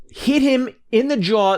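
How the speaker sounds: ordinary delivery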